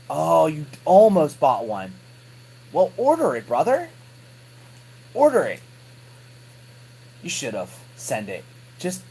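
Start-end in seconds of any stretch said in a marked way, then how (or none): 0.1-5.6 loud, high mock exasperation; exasperated urging. Real, not acted. Vlogger tells viewer to order one, loudly exasperated. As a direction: egg a buddy on, loud and high-pitched.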